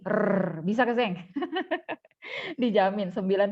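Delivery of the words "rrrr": short tongue trill; rolled r. Shown as a demonstration. Teacher daring a foreigner to copy it, rolled r. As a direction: trill an r as a challenge. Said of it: "bikin begini".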